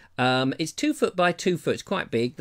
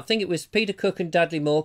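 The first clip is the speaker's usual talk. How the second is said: ordinary talk, nothing marked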